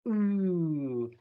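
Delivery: soft rounded ooh; teacher modelling the vowel ooh; ooh demo. Shown as a demonstration.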